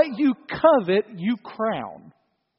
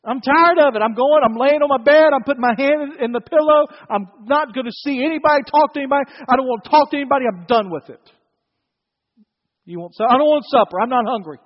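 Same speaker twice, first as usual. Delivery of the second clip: put-on loud, high sulking child voice, stomping about; whiny four-year-old; 5 semitones higher, 7 dB louder, faster than usual. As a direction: throw a loud tantrum like a little kid. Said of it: "like a four-year-old here".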